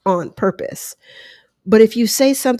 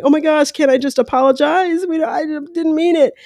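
high-pitched imitation of a sobbing apology; lightly acted weepy begging, no real sobs; mock tearful pleading; 7 semitones higher than usual. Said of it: "if he was crying and sobbing".

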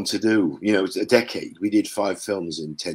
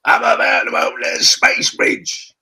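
Jetfire voice; 6 dB louder than usual. Retold or imitated. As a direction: announce yourself like a grand Transformer. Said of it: "he's actually Jet".

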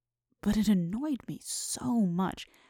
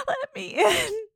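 high, wailing ghost voice, much higher; sobbing ghost child; 13 semitones higher, 6 dB louder than usual. Acted. Ghost of Catherine begging at the window, high sobbing wail. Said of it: "Still it wailed".

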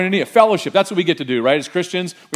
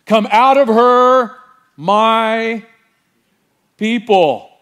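slow, loud, higher divine call; booming heavenly command; 6 semitones higher, 6 dB louder, much slower than usual. Acted. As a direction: proclaim it like God from heaven. Voice from heaven quoted.

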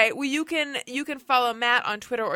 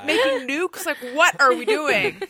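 incredulous laughing squeal; 5 semitones higher, 7 dB louder, slower than usual. Retold or imitated. Incredulous retold quote to boss.

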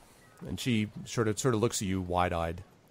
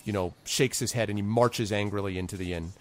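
ordinary talk, nothing marked

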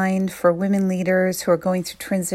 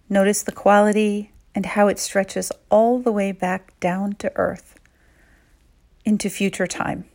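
calm, unhurried meditation voice; guided meditation. Acted. Guide leading women leaders through a visualization, calm and soothing. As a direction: lead them gently inward, pausing often.

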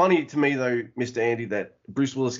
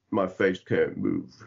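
low, slow, slurred mumble; Sylvester Stallone; 6 semitones lower, slower than usual. Acted. Co-host mocking the actor on camera, slow stiff-faced mumble. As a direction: mumble it as if the face is frozen. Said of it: "he's gotta act, he's like".

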